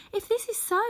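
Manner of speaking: put-on high, joyful girl's cry; joyfully. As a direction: burst out with delight, pitched high. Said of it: "said the child joyfully".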